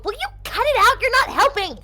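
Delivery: high exasperated scolding shout; angry scold. Acted. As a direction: tell him off sharply. She sprints up scolding thrower.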